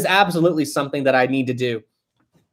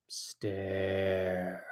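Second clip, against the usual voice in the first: whisper; 8 semitones lower, 16 dB quieter than usual. Acted. Reading a teasing chat message.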